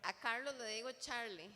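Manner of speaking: ordinary talk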